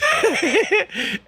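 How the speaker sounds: high burst of giggling laughter. Real, not acted. Vlogger cracking up at the lawyer's imagined thoughts, high laugh.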